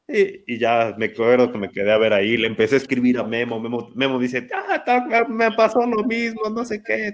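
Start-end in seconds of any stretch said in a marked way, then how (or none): 4.5-7.1 much higher, squeaky imitation of a friend; mock friend's voice. Imitating friend Memo texting back the same story, mock-high. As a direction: lightly caricature a pal who missed it too.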